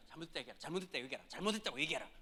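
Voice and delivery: low hushed nagging from the side; urgent hushed prompting. Retold or imitated. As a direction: urge him to apologize under your breath. Someone urging him under breath.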